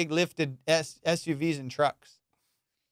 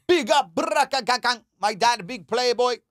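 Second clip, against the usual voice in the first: put-on higher, louder Jamaican accent with chanted sound effects; 6 semitones higher, 3 dB louder than usual. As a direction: brag like a Jamaican kid about his dad. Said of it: "He goes".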